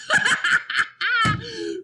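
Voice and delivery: loud, high cackling laugh; doubled up laughing. A narrator's reading. Robert cracks up over the garbage dog, doubled up laughing. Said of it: "Robert doubled up with laughter".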